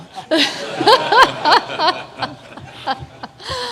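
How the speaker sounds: a big burst of real laughter, rising; loud laugh. Real, not acted. Panelist laughing hard at the Scotland joke, loud laugh.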